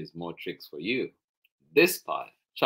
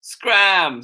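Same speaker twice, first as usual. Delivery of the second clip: loud, high command to leave; exasperated order; 10 semitones higher, 11 dB louder than usual. Acted. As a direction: order the children out sharply, raised voice. Mom orders children out.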